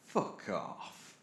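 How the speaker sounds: breathless whispered curse; whispered shock; stunned disbelief. Acted. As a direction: gasp it out under your breath. Stunned reaction to horse aboard.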